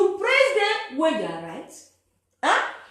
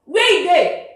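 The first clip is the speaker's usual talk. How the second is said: loud, raised complaint; shouting; 5 semitones higher, 11 dB louder than usual. Real, not acted. Man ranting at viewers about the blackout, shouting. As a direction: shout out where the power went.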